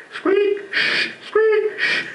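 high squeak then hushed shh, like sneakers; squeaky shoe noises. Retold or imitated. Comic mimicking his squeaky sneakers for the crowd, high squeak and hiss. Imitating squeaky Nike shoes.

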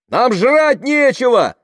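high, drawn-out desperate cry; shouted. A narrator's reading. Hungry woman to the driver, shouted. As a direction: yell it at full pitch. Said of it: "крикнула женщина".

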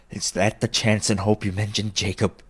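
usual speaking voice

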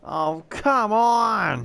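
drawn-out exasperated groan; frustrated groan. Real, not acted. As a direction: stretch it out in frustration. Dealer wins again.

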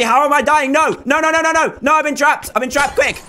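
high, urgent game panic; panicked. Real, not acted. Gamer trapped and dying in a match, panicked cries. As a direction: blurt it out high, in alarm.

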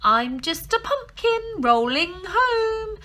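high loud pretend-pumpkin voice; squeaky granny faking innocence; disguised granny. A narrator's reading. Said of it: "called the woman".

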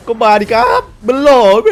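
cocky catchphrase tossed at the enemy; a hammed-up boast; quoting a catchphrase. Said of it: "คำพูดนี้คุ้นๆไหม ท่านผู้ชม".

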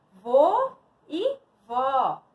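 loud, slow, spaced words; slow word reveal. Shown as a demonstration. Teacher revealing words to pupils, slow and spaced. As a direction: separate each word clearly for learners.

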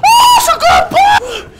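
high shriek broken by laughter; laughing scream. Acted. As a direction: scream for help while laughing. Startled by a tiger.

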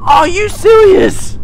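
high, drawn-out shout of disbelief; outraged disbelief. Real, not acted. Gamer protests the game's ruling, outraged disbelief.

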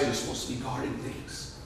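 very soft, breath-only whisper. Retold or imitated. Buddy on night guard duty, whispering. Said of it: "your whisper starts to turn".

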